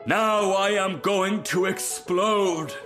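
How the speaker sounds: high, very drawn-out proclamation; exultant cry. A narrator's reading. Rocket about to burst, high slow triumphant cry. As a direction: announce it as a grand climax. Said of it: "he cried".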